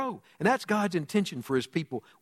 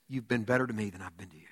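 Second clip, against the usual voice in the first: humbled, quiet; 8 semitones lower, 7 dB quieter, faster than usual. Acted. Saul humbled, answering David.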